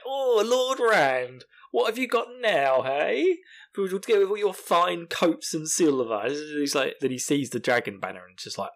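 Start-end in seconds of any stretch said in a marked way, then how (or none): 0.1-6.2 high, drawn-out mocking grandeur; taking the piss. Host as Mat teasing Lord Rand, mocking. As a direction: tease with swooping, exaggerated pomp. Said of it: "he's taking the piss out of him".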